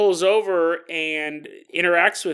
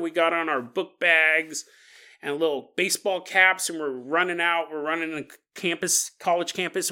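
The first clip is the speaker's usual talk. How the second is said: ordinary talk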